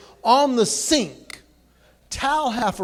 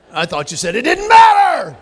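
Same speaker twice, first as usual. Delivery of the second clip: louder and higher, laughing exasperated shout; exasperated outburst; 5 semitones higher, 10 dB louder than usual. Retold or imitated. Spouse snapping about dinner, exasperated shout. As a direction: blow up at the spouse. Acted quarrel punchline.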